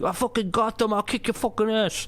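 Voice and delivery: put-on tough New York gangster accent, pitched high; Penguin's New York accent. Imitating the Penguin's threats from the show, in a New York gangster accent. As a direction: snarl it like a New York mobster. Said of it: "doing a really cool, fun accent".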